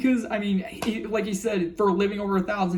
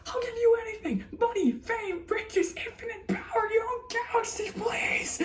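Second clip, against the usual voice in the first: much higher, desperate pleading; Bill begging; 5 dB quieter than usual. Retold or imitated. Bill begging for mercy as villain, high, pleading. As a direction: beg shrilly for your life, breaking into a laugh. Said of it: "I'll never forget Bill's from Gravity Falls".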